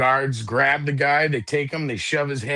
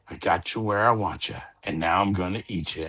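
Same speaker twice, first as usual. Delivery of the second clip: spooky voice; 6 semitones lower, 4 dB quieter than usual. Acted. Spooky voice repeated in joke.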